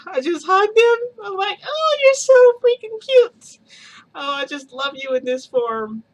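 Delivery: high, loud squealing gush, slowed down. Retold or imitated. Hugging her boyfriend in his small form, high loud gushing squeal. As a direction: squeal it like something is unbearably cute.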